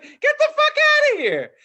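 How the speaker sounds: retold high-pitched yell; loud acted outburst; incredulous shout. Retold argument, yelling at customer.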